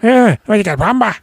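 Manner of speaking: high, loud mock menace; hammed-up tough guy calling him boy; menacing taunt. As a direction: sneer the challenge up high. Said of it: "I said".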